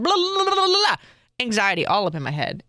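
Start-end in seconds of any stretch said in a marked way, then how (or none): 0.0-1.0 high singsong blah blah, brushing her own speech aside; mocking blah. Mother mocks her own speech to her son, high singsong. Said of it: "mocking her own speech".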